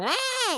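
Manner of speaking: high mock scream of waking terror; acted scream. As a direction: scream awake from a cartoon nightmare. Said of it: "he wakes up screaming".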